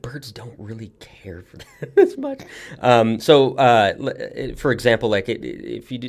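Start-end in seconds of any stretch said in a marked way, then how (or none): 0.0-2.4 hushed, with a laugh; half-laughing whisper; whispered aside. Real, not acted. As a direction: let it slip out as a whisper. Aside in low voice.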